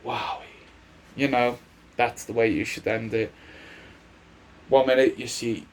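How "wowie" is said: quiet, quick creepy mock Fiend voice; a soft, eerie imitation of a wrestling villain; the Fiend's catchphrase. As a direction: hush it like a sinister puppet host. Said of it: "where he would say".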